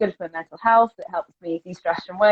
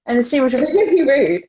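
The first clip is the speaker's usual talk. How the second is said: laughing; 6 semitones higher, slower than usual. Real, not acted. Laughing while recalling the rude girl.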